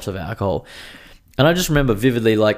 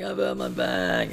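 slower, softer, dragged-out moan of pain; slow pained groan; 3 semitones higher, 5 dB quieter, slower than usual. Retold or imitated. Telling friends he's hurt, as a slow pained moan. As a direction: let it sag into a hurt moan. Retelling injury on the jetty.

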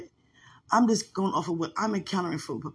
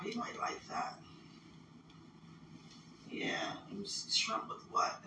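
half-whispered thought to herself; 12 dB quieter than usual. Real, not acted. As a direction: let it drop to a breath. Musing to herself about dinner.

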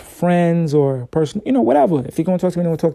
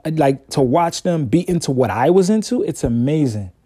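ordinary talk, nothing marked

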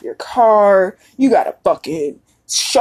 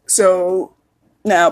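ordinary talk, nothing marked

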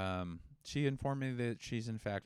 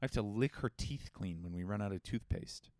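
ordinary talk

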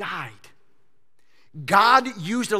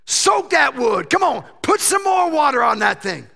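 cocky prophet yelling to pour more water; shouted urging; 5 semitones higher, 8 dB louder than usual. Pretend. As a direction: egg them on at full volume.

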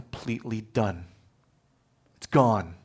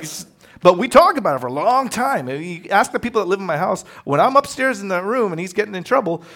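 ordinary delivery

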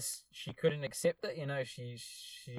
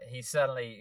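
ordinary talk, nothing marked

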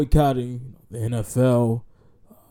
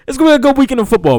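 excited; 11 semitones higher, 10 dB louder, much faster than usual. Real, not acted. Hyped about weekend.

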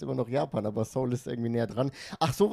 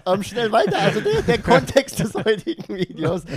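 higher, laughing through words; 7 semitones higher, 7 dB louder than usual. Real, not acted. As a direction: push on while still laughing. Nach eigenem Wortwitz lachend. (lachend weiterreden)

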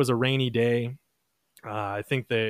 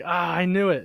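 milder "I knew it"; 7 semitones higher than usual. Retold or imitated. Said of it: "She's like".

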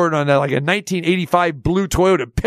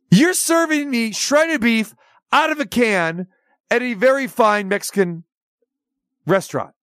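outraged disbelief; 6 semitones higher than usual. Retold or imitated. Outraged question to manager.

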